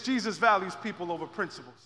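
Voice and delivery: usual speaking voice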